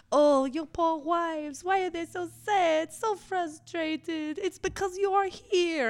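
high, slow, loud mock pity; French madame. Madame pitying the customers' wives, high and theatrical. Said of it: "just like".

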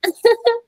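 sudden high laugh; startled laugh at being asked where to eat; high burst of laughter. Real, not acted. Asked where to find stew.

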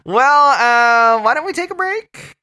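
high, loud radio announcer. Pretend. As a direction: throw to the break like a DJ. Host cues the break.

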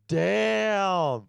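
high, loud, drawn-out retold exclamation; disguised voice. Retold awkward reply in disguised voice.

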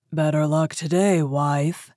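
big rough giant's voice; booming ogre. A narrator's reading. Ogre greets his wife, booming. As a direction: boom it out heavily like an ogre. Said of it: "his voice shook the house".

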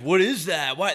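incredulous mock yelp; confused mock outrage. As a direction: imitate a dude thrown by what he sees. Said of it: "They got all confused".

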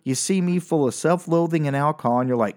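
ordinary talk, nothing marked

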